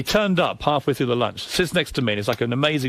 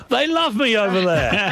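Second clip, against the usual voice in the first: higher, slower, smug mimicry of Heather; mocking her boast slowly; mocking quote; 7 semitones higher, slower than usual. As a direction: draw it out like a self-satisfied celebrity.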